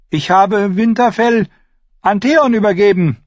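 put-on high child's voice, not yet at full shout; high-pitched young lord making a declaration; strained boyish announcement. A narrator's reading. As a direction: announce it reluctantly in a high boy's voice. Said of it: "„Lauter".

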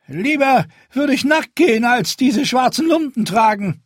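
very high, loud blustering protest; fat red-faced man. Acted. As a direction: bluster it with defiance. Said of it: "Ein dicker Mann mit rotem Gesicht".

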